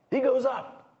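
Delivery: high, loud ringing repeat; emphatic shout. Acted. Pastor driving home David's climb to the congregation, a loud ringing cry. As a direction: hit it hard the second time.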